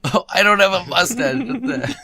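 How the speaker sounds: high, loud, slow protest; surprised protest. Real, not acted. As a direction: protest in amused disbelief. Protests a drawing, laughing.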